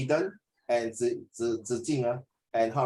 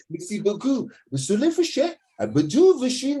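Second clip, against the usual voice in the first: higher, hammed-up French accent; mock French; 6 semitones higher, 4 dB louder than usual. Tutor joking about learning French, in a hammed-up French accent. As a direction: ham up a tourist's French. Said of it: "talk like French".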